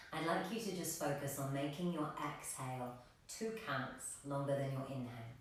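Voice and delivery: ordinary talk, nothing marked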